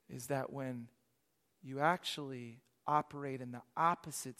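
very soft, low, measured delivery; hushed retold advice. Pastor passing on the Lord's word to baristas, hushed. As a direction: hand over advice quietly, with long pauses. Words from the Lord, spoken softly.

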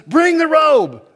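very high, loud call of the father; ringing fatherly command; father's command. Acted. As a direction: call out for the robe like a joyful father. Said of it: "when the father says".

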